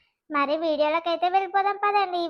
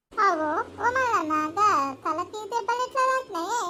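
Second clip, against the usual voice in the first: calling out to Mallanna with mild concern; lightly voiced village woman; 3 semitones higher than usual. Older village woman figure. (పల్లెటూరి పెద్దావిడ గొంతు, కొంచెం ఆందోళనతో)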